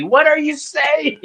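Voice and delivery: high, loud, drawn-out exasperated yell. Retold or imitated. Viewer yelling at tarot readers on TV, loud mock outrage. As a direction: holler it in hammed-up disbelief. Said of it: "I'm yelling at the T V".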